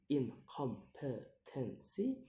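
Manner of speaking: "incompetency" slowly split into syllables; slow, separated syllables on "incompetency". Shown as a demonstration. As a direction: sound out "incompetency" piece by piece. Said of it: "count the word syllable backwards".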